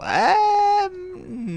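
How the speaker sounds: a long held squeak way up high; drawn-out high um before disagreeing; high doubtful um. Real, not acted.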